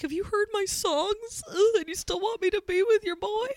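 hammed-up anxious girlfriend; self-mocking worry. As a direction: camp worried whine. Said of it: "I'm like".

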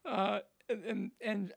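laughing while talking. Real, not acted. Comic to host about the simple joke, laughing. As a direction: talk through the tail of a laugh.

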